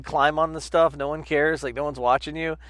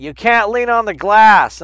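guard's scolding; 8 semitones higher, 15 dB louder, slower than usual. Retold or imitated. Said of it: "she's like".

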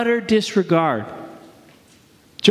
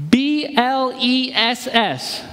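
slow letter by letter B L E S S; spelled out; 4 semitones higher, much slower than usual. Shown as a demonstration. Pastor spelling the acronym for the congregation, slowly. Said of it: "The tool spells bless".

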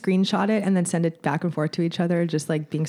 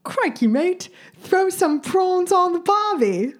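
put-on higher, slow Australian accent, second try; a more committed stab at an Australian accent; better Aussie accent; 11 semitones higher, slower than usual. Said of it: "wait, I can do it".

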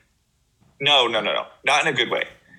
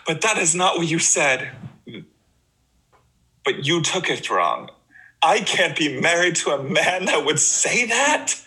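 hammed-up period-drama quarrel, higher pitch; overdramatic acting; 5 semitones higher than usual. Mocking the show's couple fighting over nothing, overdramatic. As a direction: play the wounded wife far too earnestly. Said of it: "it's not dramatic topic".

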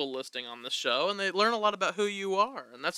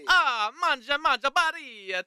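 put-on Italian accent; 7 semitones higher, slower than usual. Mock Italian chef bit.

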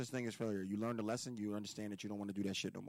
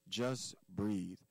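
ordinary delivery

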